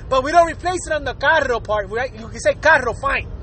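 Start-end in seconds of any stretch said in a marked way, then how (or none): none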